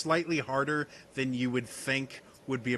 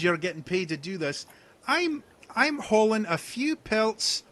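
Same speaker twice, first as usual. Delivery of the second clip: pleading hunter; 7 semitones higher, 4 dB louder than usual. Acted. Hunter pleading with the party for the deer pelt. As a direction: wheedle for the pelt with a sob story.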